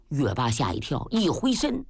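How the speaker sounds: plain reading voice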